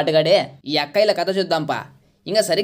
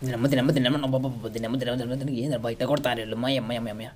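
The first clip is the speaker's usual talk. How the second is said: pained muttering; 7 semitones lower, 8 dB quieter than usual. Real, not acted. Getting shot, groaning in game.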